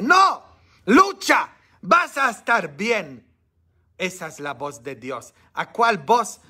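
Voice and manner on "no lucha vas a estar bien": high, loud, ringing encouragement; God's voice answering the devil, loud and high. Acted. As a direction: call out like God urging him to fight. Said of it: "la voz de Dios que dice".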